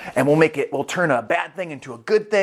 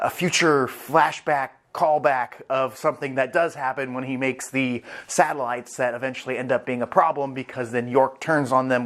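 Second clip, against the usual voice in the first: ordinary delivery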